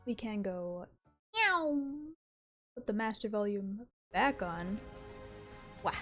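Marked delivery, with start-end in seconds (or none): 1.3-1.8 playful kitty meow, pitched up; cute meow. Pretend. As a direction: purr it out like a cat. Playful cat noise before starting.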